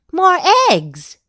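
high, loud delighted cry; excited exclamation. A narrator's reading. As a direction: marvel out loud. Delight at finding more eggs.

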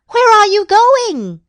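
high, loud called question; calling out. A narrator's reading. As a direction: call after someone leaving. Calling after Goose as she leaves.

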